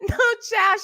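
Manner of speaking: ordinary delivery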